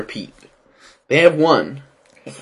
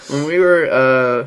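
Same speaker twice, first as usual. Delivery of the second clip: ordinary delivery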